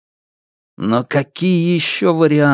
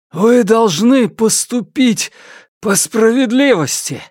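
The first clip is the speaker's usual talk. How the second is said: impassioned appeal; 10 semitones higher than usual. Acted. Фан Му facing the mob after fighting back, impassioned appeal. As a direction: call out to them for justice.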